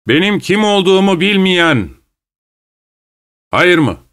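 high, projected questioning call; calling to a crowd. A narrator's reading. Kroner asks the silent crowd for questions, calling out. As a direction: throw the question out over many heads.

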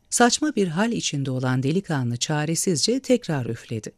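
ordinary delivery

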